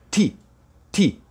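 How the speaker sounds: ti said short and quick. Shown as a demonstration. Teacher demonstrating the short ty sound. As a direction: clip ti short. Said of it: "ele é mais curto".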